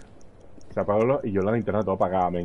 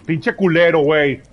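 louder, angry cursing; angry shout; 4 semitones higher, 7 dB louder, slower than usual. Real, not acted. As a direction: yell the curse out. Cursing louder the second time.

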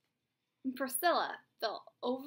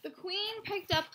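ordinary delivery

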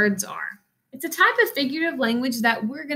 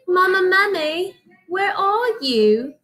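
put-on high, slow British child calling out; British accent; 8 semitones higher, slower than usual. Lost baby mummy calls for his mother in a British accent. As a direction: call for mama in a small British voice. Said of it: "read it in a British accent whenever the little mummy talks".

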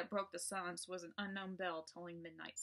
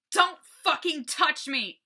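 slow, much louder and higher scream; furious shout; 8 semitones higher, 14 dB louder, slower than usual. Acted. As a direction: yell it as you shove her away. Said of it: "I shouted".

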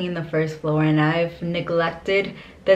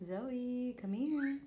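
high, soft calling to a puppy; high pet call; 6 semitones higher, 14 dB quieter, much slower than usual. Real, not acted. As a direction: coax the dog over softly.

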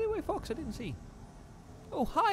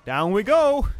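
excited shout; 7 semitones higher, 11 dB louder than usual. Real, not acted. Jumping down, calling out.

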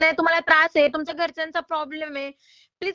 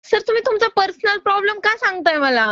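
louder, higher exasperated question; exasperated objection; 5 semitones higher, 6 dB louder than usual. Real, not acted. Loan agent objecting to a borrower's personal excuse, exasperated. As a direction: push back loudly with raised pitch.

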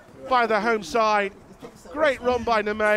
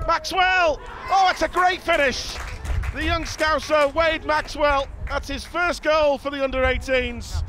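excited goal shout; 4 semitones higher than usual. Real, not acted. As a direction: shout the name then savour the finish. Goal called live.

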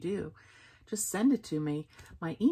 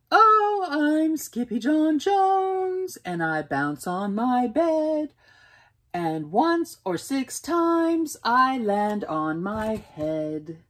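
bouncy kitten; 6 semitones higher, 3 dB louder, slower than usual. Acted. Kitten sings while bouncing.

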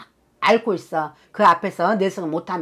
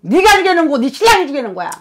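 sharp, high, loud blaming cry; fierce accusation; 8 semitones higher, 8 dB louder, faster than usual. Acted. Spirit through the shaman blaming mother and husband, high and loud.

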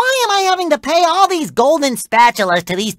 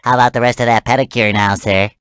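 ordinary delivery

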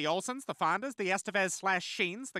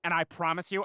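ordinary delivery

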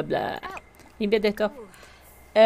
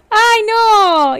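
laughing; 9 semitones higher, 8 dB louder than usual. Real, not acted. Still laughing at the letter.

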